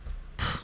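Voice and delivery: soft, wordless puffs of breath; huffing and puffing. Retold or imitated. Stressed dog owner staring out the window, huffing. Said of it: "Literally huffing and puffing".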